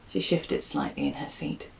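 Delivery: usual speaking voice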